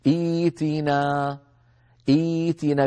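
إيتنا with kasra hamza then long ya, drawn out slowly; tajweed demo. Shown as a demonstration. Teacher showing students how to say إيتنا. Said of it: "بهمزة مكسورة بعدها ياء ساكنة".

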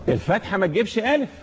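ordinary delivery

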